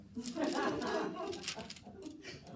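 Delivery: high breathy giggle; real laugh. Real, not acted. Preacher laughing with the congregation, high giggle. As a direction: laugh at your own joke.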